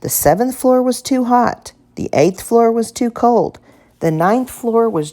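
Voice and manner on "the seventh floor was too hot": ordinary delivery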